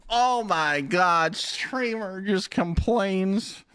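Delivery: high, slow whiny commenter; slow, sneering mock complaint; mocking hater. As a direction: drag it out like a smug hater. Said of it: "the heat I would get".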